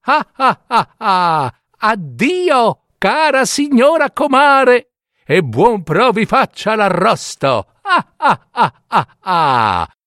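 high, cackling taunt shouted in triumph; the fox gloats and laughs at the duped wolf; sneering laughing farewell; mocking laughter. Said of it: "gridò".

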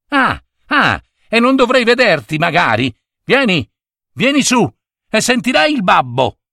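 high-pitched, brisk maternal telling-off; scolding mother. A narrator's reading. Mother to son from balcony, scolding call. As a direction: summon the child with a mild threat.

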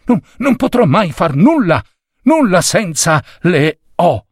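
can't do anything without the O's, out of breath; breathless dismay. A narrator's reading. Boy panting his bad news to the superior. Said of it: "disse annaspando per via del fiato corto".

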